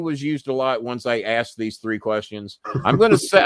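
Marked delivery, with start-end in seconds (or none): 2.8-3.4 excited outburst. Real, not acted. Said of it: "I'm gonna save the three".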